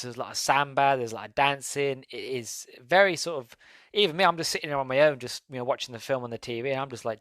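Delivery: normal talking voice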